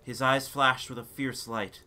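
ordinary delivery

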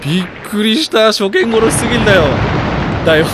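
real jittery outburst; shaken complaint. Real, not acted. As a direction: still reeling from the scare. After being badly startled.

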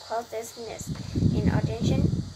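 plain reading voice

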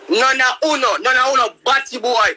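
high, taunting, defiant; high-pitched defiant taunting. Real, not acted. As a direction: challenge them all.

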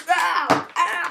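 sharp high yelps of Ow; real pained cries; loud ow. Real, not acted. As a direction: yelp at the sudden pain. Hurt himself on camera.

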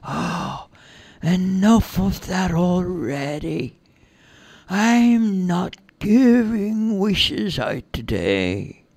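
high, slow, drawn-out fairy voice; captured fairy, lofty and slow in a far-away accent. Acted. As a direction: stretch the words in an otherworldly accent. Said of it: "in a kind of far-away accent".

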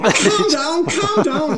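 high, laughing Scouse "calm down"; lightly put-on Scouse, laughing; Scouse accent. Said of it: "That's my bit of an accent".

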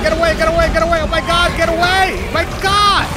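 loud, high, frantic repeated shouts; panicked yelling. Real, not acted. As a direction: yell urgently as the monster closes in. Frantic fight as the monster attacks.

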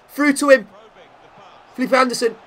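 high, loud rising excitement; excited commentary. Real, not acted. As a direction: call the attack like it's about to go in. Live attack building to shot.

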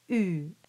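"U" as ee through rounded lips; rounded French U. Shown as a demonstration. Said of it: "round around it".

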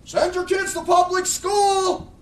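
slow, high, loud mocking outcry; outraged shout. Real, not acted. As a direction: hurl it at the congregation in disbelief.

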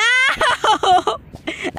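high nervous laugh. Real, not acted. Nervous outburst.

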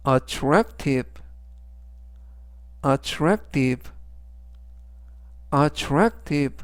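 "attractive" slowly, stressed on the middle syllable TRAC. Shown as a demonstration. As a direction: model "attractive" for learners, leaning on the second syllable. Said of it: "이때 강세는 중간 부분에 있는". ("attractive"의 중간 A에 강세를 두고 또박또박)

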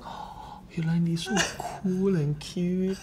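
drawn-out admiring sigh; gushing adoration. Retold or imitated. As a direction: coo it slowly, stretching the praise. Imitating the crew's gushing reaction.

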